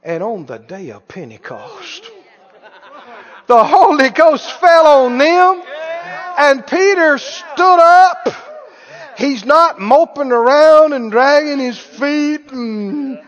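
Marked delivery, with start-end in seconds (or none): 3.5-13.2 loud, high, slow preacher's cry; full-force preaching. Real, not acted. Preacher telling of Pentecost to congregation, preaching at full force. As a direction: proclaim it loudly, stretching the big words.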